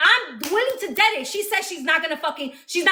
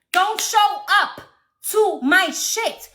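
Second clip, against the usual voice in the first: slow warning; 4 semitones higher, much slower than usual. Real, not acted.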